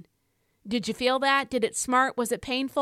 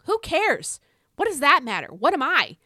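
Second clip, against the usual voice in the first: heated outburst; 4 semitones higher, 4 dB louder than usual. Real, not acted. Preacher dismissing offense, heated.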